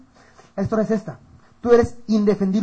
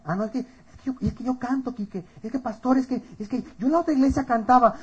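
higher, softer mock pleading; whiny excuse-maker; 5 semitones higher, 5 dB quieter than usual. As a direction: imitate someone stammering excuses to the pastor. Imita excusa de un feligrés. (excusa quejumbrosa imitada)

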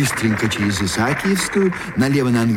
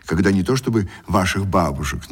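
ordinary talk

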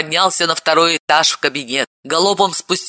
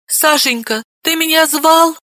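much higher meek question; timid wife; 11 semitones higher than usual. A narrator's reading. Frightened wife peeking into the room, meek high voice. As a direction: ask meekly.

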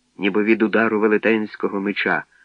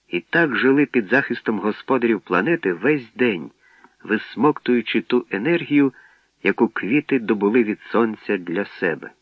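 ordinary delivery

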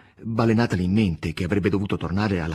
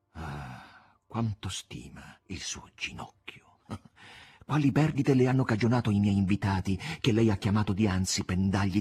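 softened voice; 2 semitones lower, 4 dB quieter than usual. Acted. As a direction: soften your voice, coaxing her. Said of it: "addolcendo la voce". (addolcendo la voce, morbido e basso)

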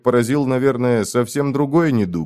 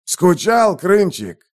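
mocking high greeting; teasing; 9 semitones higher, much slower than usual. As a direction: tease him cheerfully. Левгяр дразнит Рыжего. (насмешливо)

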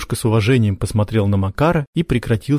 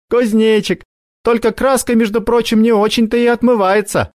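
put-on teasing girl; 13 semitones higher, 4 dB louder than usual. Sonya teasing voice.

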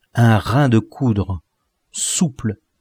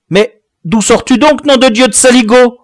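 loud, high, furious demand; angry high-pitched outburst; roared scolding; 13 semitones higher, 8 dB louder than usual. A narrator's reading. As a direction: thunder at the boy as an outraged father. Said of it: "gronda le père Lebrac".

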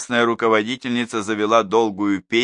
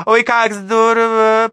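much higher, louder, slow gushing cry; delighted gush; 9 dB louder, slower than usual. A narrator's reading. Neighbour gushes at Danilov being a doctor, habitual delight. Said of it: "привычно восхитилась соседка".

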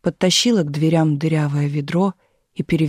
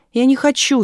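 put-on resisting child; 7 semitones higher, 4 dB louder than usual. A narrator's reading. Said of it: "сопротивлялась Галина".

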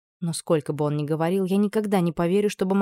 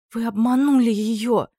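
anguished outcry; 4 semitones higher, 4 dB louder, slower than usual. A narrator's reading. Girl cries out in tears.

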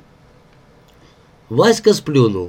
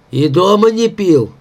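high-pitched puzzled question; bewildered; 6 semitones higher than usual. A narrator's reading. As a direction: ask in baffled disbelief. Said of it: "недоумённо вытаращился на него Егорыч". (недоумённо, с удивлением)

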